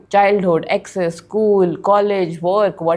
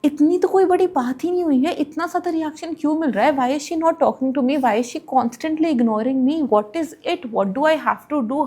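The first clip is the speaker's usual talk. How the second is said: higher, rushed, fretting inner voice; anxious spiral; 6 semitones higher, much faster than usual. Retold or imitated. Spiralling over the roommate's cold shoulder, higher and fretful. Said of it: "I was in a full spiral mood".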